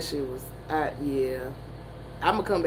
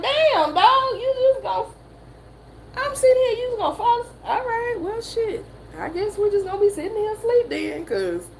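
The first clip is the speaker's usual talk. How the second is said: mock indignant friend. Teasing a sleeping friend, high mock indignation. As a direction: pitch it way up and play betrayed. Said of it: "I was like".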